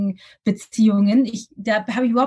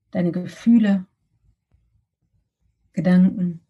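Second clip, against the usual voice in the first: guided meditation; 3 semitones lower than usual. Acted.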